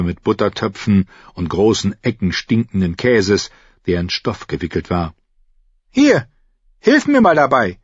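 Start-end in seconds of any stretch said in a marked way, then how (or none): 5.9-7.8 high, bossy order to a helper; commanding. Acted. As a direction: snap out a quick command. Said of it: "befahl sie Arüa".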